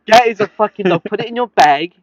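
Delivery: high, loud disbelieving yell; exasperated shout. Real, not acted. Ranting to a friend about the dog at the desk, loud disbelief.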